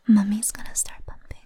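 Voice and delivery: usual speaking voice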